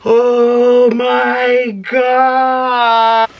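very slow, loud, high oh my God; delighted shout. Real, not acted. Unboxing a fan's package, a loud, slow, thrilled oh my God. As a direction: stretch it out in a loud thrilled cry. Reacting to gift contents.

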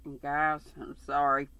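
ordinary delivery, nothing marked

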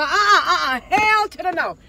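high, loud hell no; emphatic loud refusal; loud sassy refusal. Real, not acted. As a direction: shut it down with attitude. Refusing with sass.

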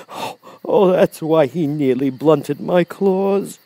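high, drawn-out lion voice; Cowardly Lion, dismayed. A narrator's reading. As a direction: let it dawn slowly on the timid lion. Lion realises.

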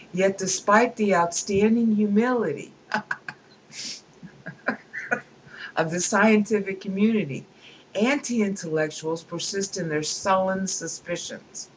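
amused read-aloud. Acted. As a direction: read the passage with relish. Reads book passage aloud.

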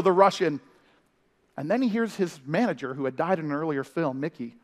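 normal talking voice

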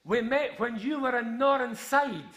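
slow, high shout for all to hear; loud shout. Retold or imitated. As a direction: imitate an embarrassingly public holler. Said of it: "he shouted in a, a really loud voice".

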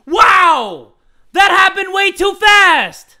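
loud, high yell; exasperated shout. Real, not acted. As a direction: blow up in frustration. Run fails again.

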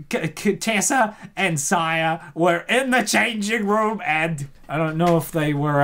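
ordinary talk

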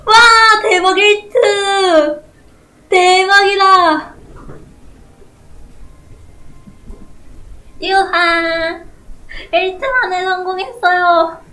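high, loud victory yells; excited shouting. Real, not acted. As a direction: celebrate at full volume. Celebrating the upgrade.

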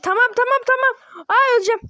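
high, loud gamer panic; yelling in a rush as the fight turns; panicked outcry. Real, not acted. Panicking mid-battle.

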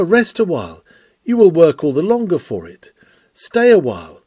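ordinary talk, nothing marked

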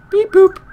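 high, loud robot beep-boop; squeaky button-press noises; imitated electronic beeps. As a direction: chirp like a little machine. Imitates keycard machine noises.

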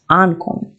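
Ankommen with the first syllable AN stressed; AN-kommen, prefix accented. Shown as a demonstration. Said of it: "тому на нього падає наголос".

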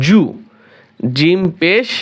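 ordinary talk, nothing marked

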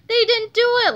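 high, loud gleeful voice; amused crowing. A narrator's reading. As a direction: say it as if holding in laughter. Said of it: "laughed Miss Ribble".

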